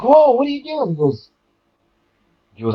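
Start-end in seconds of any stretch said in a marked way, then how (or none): 0.0-0.9 high-pitched startled retold whoa; alarmed. Storyteller reliving his shock at the man by the barrel, alarmed. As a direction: pitch leaps up in mock alarm.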